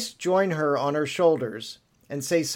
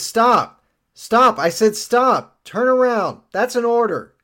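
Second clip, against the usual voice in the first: shouting orders louder and higher; shouted orders; 8 semitones higher, 8 dB louder than usual. Acted. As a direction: yell stop, commanding. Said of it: "He says".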